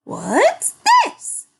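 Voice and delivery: high, loud startled squawk. A narrator's reading. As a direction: squawk in alarm. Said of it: "squawked Mary".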